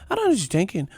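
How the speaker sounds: high-pitched mock Irish accent; Conor McGregor impression. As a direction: parody fans putting on the fighter's Irish brogue. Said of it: "I do see that with Conor fans".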